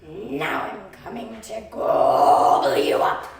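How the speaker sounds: troll growling to eat the goat; troll threat. Acted. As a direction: boom the threat.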